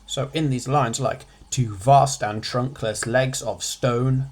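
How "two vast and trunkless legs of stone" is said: "Two vast and trunkless legs of stone" read slowly, beating each stress; rhythmic reading. Shown as a demonstration. Teacher reading the poem's line to show its rhythm, slow and beating. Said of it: "you hear the rhythm".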